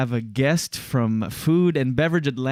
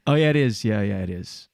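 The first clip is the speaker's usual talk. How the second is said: usual speaking voice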